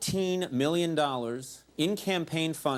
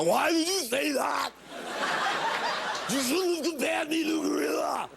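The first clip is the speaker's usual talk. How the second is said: put-on Arnold's Austrian accent; 11 semitones higher than usual. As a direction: bellow it like an offended Austrian action star. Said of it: "I'm gonna get a call from Arnold now".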